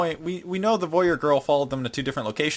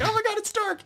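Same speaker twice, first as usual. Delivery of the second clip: mock freak-out. Rena panics locked in the storage room, mock freak-out. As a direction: squeak in hammed-up fright. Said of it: "Rena freaks out".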